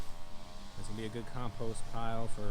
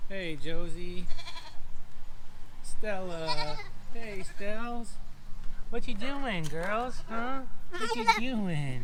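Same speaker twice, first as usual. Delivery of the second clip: pet talk; 9 semitones higher, much slower than usual. Real, not acted. Farmer greeting his goats Josie and Stella, high cooing pet voice. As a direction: coo up high like greeting pets.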